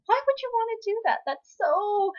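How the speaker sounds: high-pitched mock rant, quick and incredulous. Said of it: "he's ranting and raving".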